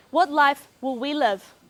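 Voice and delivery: normal talking voice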